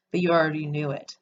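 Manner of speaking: ordinary talk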